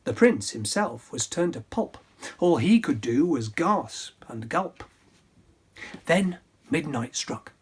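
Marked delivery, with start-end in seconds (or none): none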